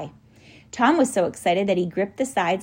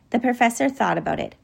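plain reading voice

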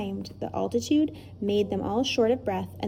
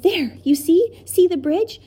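high and loud triumph; a boy's triumphant cry; 9 semitones higher, 6 dB louder than usual. Acted. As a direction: crow it out in victory. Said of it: "With triumph in his voice".

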